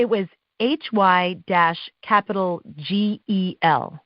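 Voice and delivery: spelled slowly letter by letter, H Y dash capital G E L; spelling out letters. Shown as a demonstration. Announcer reading the odd script spelling aloud, letter by letter. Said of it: "wrong pronunciation on my script".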